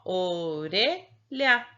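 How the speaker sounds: ORELHA very slow, broken into syllables. Shown as a demonstration. Teacher recaps one syllable per box, "orelha" drawn out. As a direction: stretch "orelha", setting the last syllable apart. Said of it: "cada sílaba em um quadrinho".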